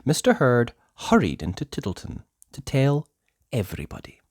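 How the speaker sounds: ordinary delivery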